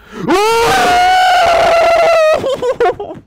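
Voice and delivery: very high, loud, sustained shriek; drawn-out high-pitched wail; long piercing scream. Real, not acted. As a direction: scream at length in gamer despair. Fails after near win.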